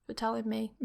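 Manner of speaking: soft, sleepy mumble; a drowsy reply to bad news, very soft; half asleep. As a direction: answer like you're barely awake. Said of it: "half asleep and just being like".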